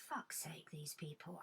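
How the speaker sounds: whispered, low grumble; muttered exasperation. Real, not acted. Host fed up with callers, muttered aside. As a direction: mutter it under your breath, fed up.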